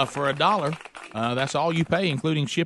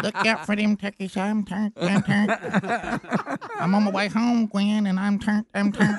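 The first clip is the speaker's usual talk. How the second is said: lightly voiced caller chanting a catchphrase; Gary impression; 4 semitones higher than usual. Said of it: "I wanted to hear Gary do the, uh, I'm Turned song".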